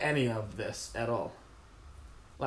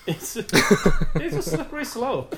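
slow words through laughter; laughing; 6 semitones higher, 9 dB louder, slower than usual. Real, not acted. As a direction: laugh it out slowly. Laughing at the line.

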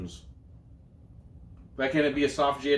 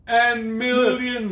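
slow, much higher, stretched "millions"; both hosts stretching out "millions" in mock wonder; drawn-out mock awe; 9 semitones higher, 4 dB louder, much slower than usual. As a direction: savour the money, dragging it out. Mocking huge athlete salaries.

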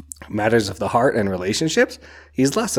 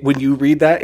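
higher, louder rush of excitement; real fan excitement, higher and louder; excited; 5 semitones higher, 6 dB louder than usual. Real, not acted. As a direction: let the thrill spill over. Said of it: "sorry for the whole noise I'm making".

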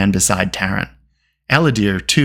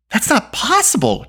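high, shocked protest; cried in disbelief; 13 semitones higher than usual. A narrator's reading. Taryn hearing the cauldron is gone, disbelieving cry. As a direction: cry out refusing to believe it. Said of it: "Taryn cried".

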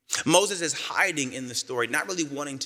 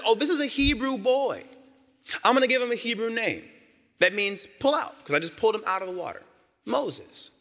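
pitched-up mock princess voice; high playful princess voice; 7 semitones higher than usual. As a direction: voice the princess opening a parcel, amused. Pharaoh's daughter voiced, high.

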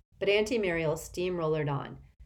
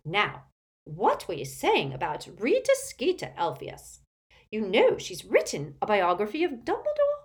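high, swooping busybody character voice; gossipy imperious old aunt; 11 semitones higher, 6 dB louder than usual. A narrator's reading. Aunt quizzing Elphias about the biography, eager and overbearing. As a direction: relish the scandal with big pitch leaps.

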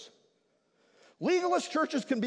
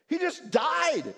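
ordinary delivery

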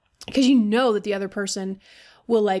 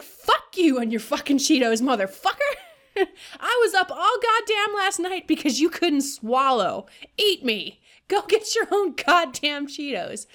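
exasperated and a little higher, cursing at the partner; mock exasperated rant; 6 semitones higher than usual. Caregiver venting at a demanding partner, mock exasperated. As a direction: blow up at someone whining for snacks.

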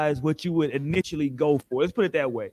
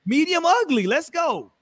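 excited; 8 semitones higher than usual. Real, not acted. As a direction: crow it with delight. Gleefully repeating the new term.

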